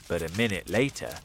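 ordinary delivery, nothing marked

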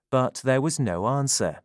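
ordinary delivery

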